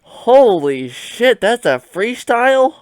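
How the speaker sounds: high, loud and slow; retold astonishment, voice jumping high; stunned disbelief. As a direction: drag it out in awe. Recalling being stunned by the track.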